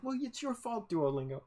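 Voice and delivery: high-pitched playful protest; mock complaint. Learner blaming the language app for wrong guesses, mock complaint.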